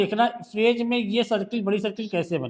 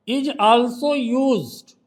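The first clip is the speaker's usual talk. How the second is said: each word drawn out slowly, a touch louder; slow dictation; 2 semitones higher, 4 dB louder, much slower than usual. Shown as a demonstration. Teacher dictating the rule to a student, very slow. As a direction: read it out at dictation speed for the class to copy. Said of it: "reads the rule slowly aloud".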